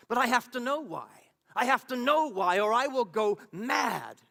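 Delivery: loud, high and insistent; desperate outburst. Acted. Troubled believer demanding answers from the voice, desperate outburst. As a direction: cry it out, stretching the final words. Your own madness that makes you so desperate.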